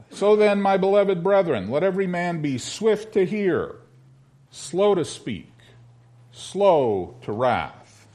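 loud, ringing scripture reading, slowing at the end; preached scripture. Acted. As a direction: proclaim the verse to the congregation. Reads scripture verse aloud.

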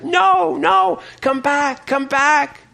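high, pleading father calling out; father calling kids back. Retold or imitated. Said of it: "And he's like".

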